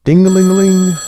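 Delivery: high, loud ding-a-ling like a telephone bell; playful telephone ring; ringing phone sound. A narrator's reading.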